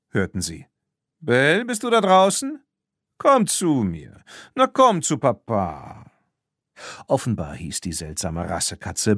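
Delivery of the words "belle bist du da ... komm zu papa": high, loud coaxing call to the cat. Acted. Lupin calling his lost cat, loud and coaxing. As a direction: call her in like a doting owner.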